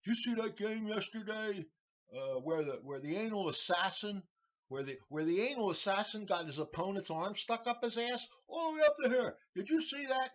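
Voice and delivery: high, loud ranting boss, climbing to a yell; angry boss. Retold or imitated. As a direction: rant it like a ticked-off boss. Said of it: "and he says".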